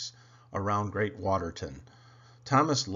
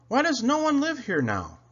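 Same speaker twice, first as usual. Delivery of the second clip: high, louder puffing question; high engine voice, lightly puffed; 12 semitones higher, 4 dB louder than usual. A narrator's reading. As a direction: puff it out curious. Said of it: "puffed Thomas".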